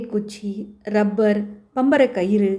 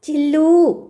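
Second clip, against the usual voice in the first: loud, higher call to the dog; 5 semitones higher, 5 dB louder, slower than usual. Acted. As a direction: call the dog loudly, the last time. Boy calling his dog louder. (நாயை உரக்க அழைத்தல்)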